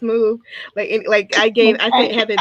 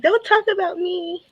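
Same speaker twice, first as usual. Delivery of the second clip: higher, drawn-out mock objection; child's silent protest; 11 semitones higher than usual. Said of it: "She's looking like".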